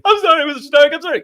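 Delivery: high, loud, frantic sorry; panicked apology. Retold or imitated. Hero apologizes to Tony, imitated as a frantic loud plea.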